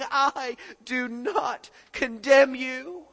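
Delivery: slow, deliberate, loud I do not condemn you; Jesus speaking to the woman, slow and measured; slow gentle quote. Retold or imitated. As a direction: lay each word down slowly.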